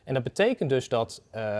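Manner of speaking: ordinary talk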